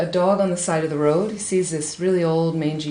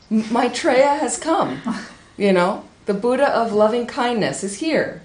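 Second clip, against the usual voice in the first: higher, louder, slow proclamation; excited announcement; 5 semitones higher, 4 dB louder than usual. Acted. Asanga announcing Maitreya to the villagers, excited. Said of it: "So he's so excited".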